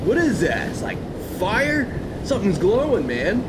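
laid-back surfer bro; stoned beach bro voice; surfer dude. Acted. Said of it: "the surfer voices".

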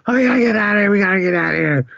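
very high, rushed mock-panicked whine. Imitating Liz trapped in the funhouse, mock panic. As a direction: imitate a frantic girl desperate to escape.

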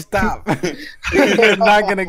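shouting no, laughing; loud protest. Real, not acted. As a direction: yell it in protest. Friend protests eating on mic.

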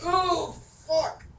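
surprised. Real, not acted. Sudden bad roll at table.

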